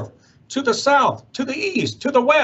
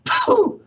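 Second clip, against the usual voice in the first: high snorting pooh; a sudden high huff through the nose; mock sniff; 3 dB louder than usual. A narrator's reading. Lorax huffing at the smog, high and sharp. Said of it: "He coughed and he whiffed".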